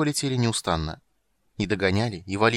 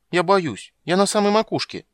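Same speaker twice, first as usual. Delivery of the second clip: put-on high, loud frightened child; small girl afraid up a tree; scared little girl; 10 semitones higher, 6 dB louder than usual. A narrator's reading. Ciri voiced as child. (испуганная девочка)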